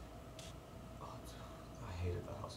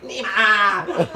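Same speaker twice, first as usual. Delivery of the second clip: louder, higher call of the name, half laughing; mock honking shout; 8 semitones higher, 9 dB louder than usual. Taiwanese driver honking and yelling the name in mock anger. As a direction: bark the name out like an angry driver.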